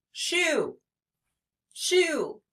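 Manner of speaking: "Shoe" in one high, falling beat; high-pitched teacher demo of "Shoe"; single syllable demo. Shown as a demonstration. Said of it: "do the syllables in shoe".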